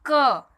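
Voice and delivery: क said loud and clear; demo syllable. Shown as a demonstration. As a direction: model क for the class to repeat. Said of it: "आप बोल के देखिए".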